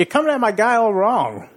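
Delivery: a hint of a famous actor's voice; Christopher Walken. Contestant takes a turn at the line, light impression. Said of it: "your best Christopher Walken impression".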